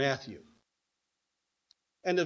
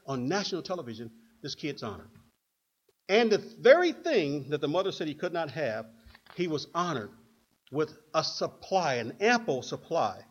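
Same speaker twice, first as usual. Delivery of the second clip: usual speaking voice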